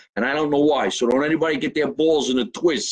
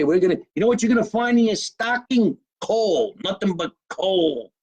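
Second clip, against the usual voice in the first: parents teasing; 6 semitones higher than usual. Retold or imitated. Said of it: "Remember they used to say".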